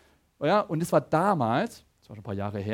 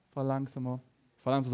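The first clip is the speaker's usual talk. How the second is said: softer, low-key mumbled repeat; mild nudge, barely raised; gentle quiet request; 4 semitones lower, 6 dB quieter than usual. Retold or imitated. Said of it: "Es war dann nicht mehr".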